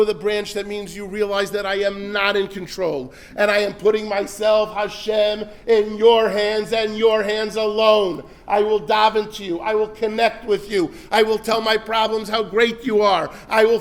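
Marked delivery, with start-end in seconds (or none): none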